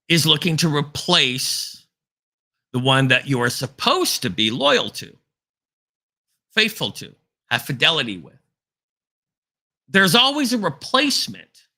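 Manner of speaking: full-force preaching. Acted. Preacher warning listeners that evil replaces the faithful, full-force preaching. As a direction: hammer each phrase like a revival preacher. Continues the sermon after aside.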